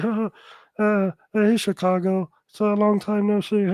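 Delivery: mock the viewer's silly showbiz greeting; mock Albert impression. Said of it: "did he talk like this?".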